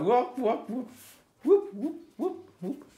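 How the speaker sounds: high, playful whoop whoop as each hair is drawn; whooping sound effects. Shown as a demonstration. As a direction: swoop the voice up on every whoop. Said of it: "you have to make the sound effects".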